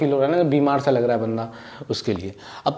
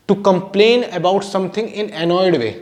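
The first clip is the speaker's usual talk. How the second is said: slow dictation; 3 semitones higher, much slower than usual. Shown as a demonstration. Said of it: "dictating meaning slowly".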